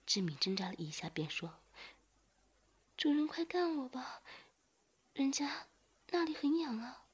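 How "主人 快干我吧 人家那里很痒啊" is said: much higher, coaxing voice; pleading character voice. A narrator's reading. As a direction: beg in a high, eager voice. Narrator voices the young man begging.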